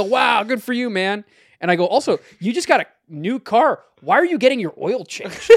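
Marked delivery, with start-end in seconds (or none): 0.0-1.2 high, loud put-on enthusiasm; mock congratulation. Mock congratulation of friend's new car. 1.9-5.5 loud, high-pitched challenge; incredulous questioning. Retold or imitated. Retold incredulous challenge to his friend.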